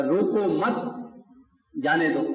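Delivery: روکو مت run together, pause, then جانے دو falling; pause after مت. Shown as a demonstration. Preacher shows the sentence meaning let him go, pause after مت. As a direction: break the phrase after مت, then drop جانے دو. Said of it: "تو وہ اور مفہوم ہو".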